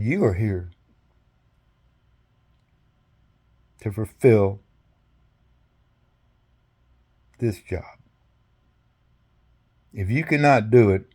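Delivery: low, measured, long pauses between phrases; solemn charge. Retold or imitated. Soldier urging the general to do his job, solemn and measured. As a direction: weigh every phrase, leaving heavy silences.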